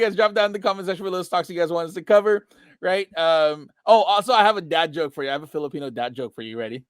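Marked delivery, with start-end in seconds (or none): none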